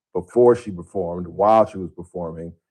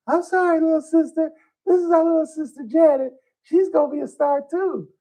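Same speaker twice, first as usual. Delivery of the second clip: very high, put-on teenage boy voice; Michael. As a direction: imitate a big brother in falsetto. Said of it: "he's only about sixteen, seventeen. He's like".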